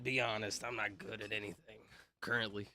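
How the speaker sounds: a breathless whisper, barely voiced; whispered aside. Real, not acted. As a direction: drop to a whisper to toss it off.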